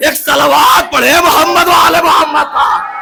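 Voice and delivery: high, loud rallying cry; loud call to the crowd. Real, not acted. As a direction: call out to the whole gathering. Calls the crowd to salawat. (مجمع کو بلند آواز سے پکار)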